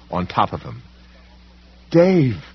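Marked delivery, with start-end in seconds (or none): 1.9-2.4 high loud scream of a name; shriek. Acted. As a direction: cry out in alarm. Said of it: "Naomi shrieked".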